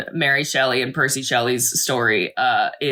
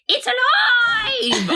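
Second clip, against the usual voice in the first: put-on shrieking old lady; much slower than usual. Co-host imitating old ladies in the movie, laughing shriek. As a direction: screech it like a tiny old lady. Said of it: "tiny little ladies is like".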